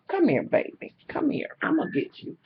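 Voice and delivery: hushed little call to a dog; coaxing a puppy. Real, not acted. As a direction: coax the puppy quietly.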